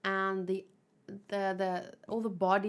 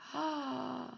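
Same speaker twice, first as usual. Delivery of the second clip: sharp, audible gasp of shock; 4 semitones higher, 6 dB quieter than usual. Acted. Recognising Nile among the Titans, gasping.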